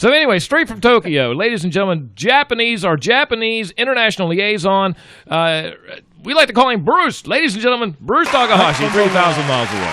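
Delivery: loud, high showman announcer voice; ring announcer intro. Acted. Host hyping the Japanese liaison to the audience, loud, high announcer call. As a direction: boom it out like introducing a prizefighter.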